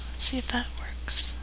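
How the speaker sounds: hushed whisper aside. Real, not acted. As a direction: whisper it to the listeners. Host aside during prank call.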